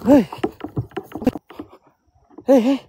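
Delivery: high loud call into the dark. Real, not acted. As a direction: call out sharply, alarmed. Memanggil ke arah suara.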